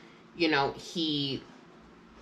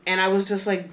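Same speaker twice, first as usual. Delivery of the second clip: usual speaking voice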